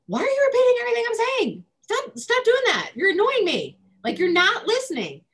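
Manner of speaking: put-on high-pitched, loud, exasperated whine of a fed-up kid; annoyed kid yelling. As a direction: snap back like a kid sick of being parroted. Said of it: "and the kids".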